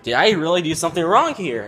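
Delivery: loud, high-pitched worried question; retold panic. Boy doubting himself after being ignored, panicked. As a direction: voice jumps up in alarm.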